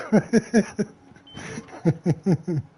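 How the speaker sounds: a real burst of loud laughter, high. Real, not acted. Laughing at the story.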